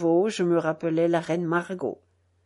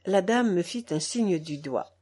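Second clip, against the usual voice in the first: ordinary delivery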